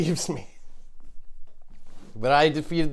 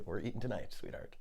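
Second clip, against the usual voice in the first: whispered promise, much softer; hushed to the turkey; 5 semitones lower, 14 dB quieter than usual. Acted. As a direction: murmur it to your prey, very soft. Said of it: "I'd say".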